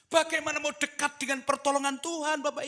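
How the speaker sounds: very high, loud pulpit cry; full-force preaching. Real, not acted. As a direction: shout it out to the congregation, voice held high. (berkhotbah lantang dengan nada tinggi)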